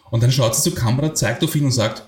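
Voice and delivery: ordinary delivery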